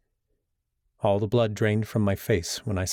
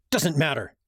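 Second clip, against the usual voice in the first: higher, sharp impatient snap; snapped dismissal; 6 semitones higher than usual. Acted. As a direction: cut him off impatiently. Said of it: "I snapped".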